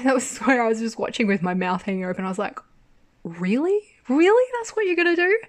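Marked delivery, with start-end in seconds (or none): 3.2-5.4 high, rising disbelief; escalating incredulous questions. Retold or imitated. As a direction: retell your outraged disbelief, pitch climbing. Retold disbelief at the twist.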